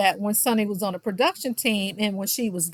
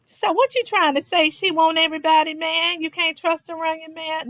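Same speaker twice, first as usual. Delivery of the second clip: high, insistent imitation on one held pitch; mock challenge; 6 semitones higher than usual. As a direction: push the accusing questions out high, mimicking her. Imitates Tisha challenging the group.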